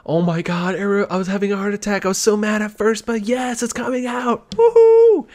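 high gushing voice climbing to a squeal; overexcited fans. Retold or imitated. Imitating the timeline celebrating the game announcement, overexcited. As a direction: imitate ecstatic fans, pitch rising to a whoop. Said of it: "the timeline's like".

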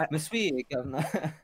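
half-joking Monsieur said with British twang; mock cockney-French accent. Riffing on bad dubs, light mock British-French accent. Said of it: "thick British accents".

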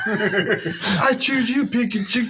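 slow, high anime battle cry; trainer battle cry. Pretend. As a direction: call out a Pokemon slowly. Pokemon trainer call quoted.

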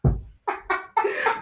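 breathy high burst of laughter. Real, not acted. Bursts out laughing mid-song.

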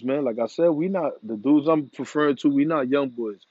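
ordinary delivery

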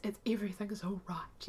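breathy whisper imitating an awkward man. Man whispering awkward comfort to upset Kathy, hushed.

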